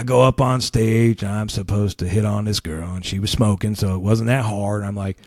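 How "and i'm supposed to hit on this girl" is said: usual speaking voice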